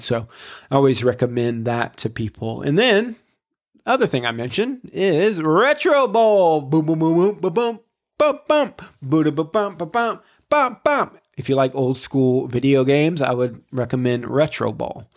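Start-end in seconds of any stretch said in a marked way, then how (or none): 2.6-11.2 excited. Real, not acted. Hyping the game with beat sounds.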